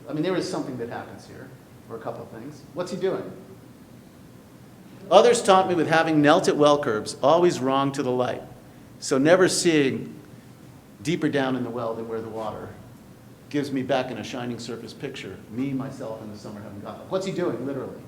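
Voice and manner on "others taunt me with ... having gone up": poem reading. Acted. Lecturer reads the poem to the class, poem reading. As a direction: read poetry aloud with a strong opening that eases off.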